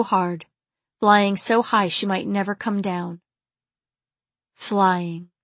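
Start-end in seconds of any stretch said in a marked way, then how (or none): none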